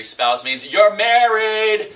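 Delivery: loud, high, slow retort; emphatic retort. Retold or imitated. Realtor retelling correcting the client, loud slow retort. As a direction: lean hard into each word. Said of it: "and I said".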